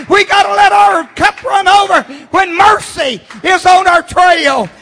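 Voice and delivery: loud, high whooping preacher; preaching at full force. Acted.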